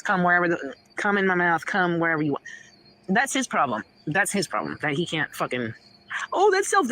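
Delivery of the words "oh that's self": high-pitched mock objection; mocking imitation. Woman parroting the critic who calls it self-deprecating, mocking.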